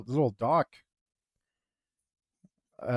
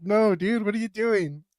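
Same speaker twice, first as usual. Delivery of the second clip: exasperated; 6 semitones higher than usual. Retold or imitated. Narrator scolds the guy.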